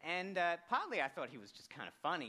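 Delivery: ordinary delivery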